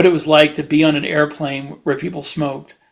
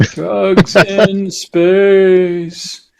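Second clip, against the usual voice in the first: drawn-out, slow and louder, savouring each word; slow echo; 4 semitones higher, 5 dB louder, much slower than usual. Acted. Echoing the panel title back to his co-host, slow and grand.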